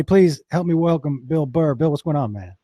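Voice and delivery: usual speaking voice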